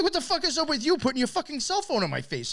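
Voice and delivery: high-pitched indignant retort; indignant comeback. Retold or imitated. He retells snapping at the guy filming him, indignant.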